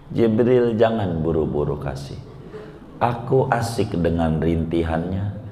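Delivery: a hint of a loving God in no hurry to give; preacher voicing God telling Jibril to hold back, low and gentle; God's gentle voice.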